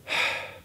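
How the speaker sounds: soft sigh. Real, not acted. Remorseful pause.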